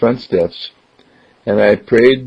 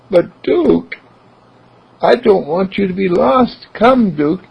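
put-on higher, pleading child voice; pleading boy; 11 semitones higher than usual. Philip pleading for his lost dog, higher child voice. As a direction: beg the dog to come back.

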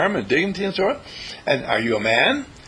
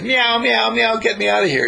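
high, louder meowing plea; kitten calling for help; 8 semitones higher, 3 dB louder than usual. Retold or imitated. Kitten in the toilet bowl calls out in a high meow. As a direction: mew like a stuck kitten crying for rescue. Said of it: "falls in the toilet bowl and says".

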